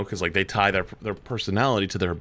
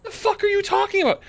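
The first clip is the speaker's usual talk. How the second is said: incredulous retort; faster than usual. Retold or imitated. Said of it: "I was like".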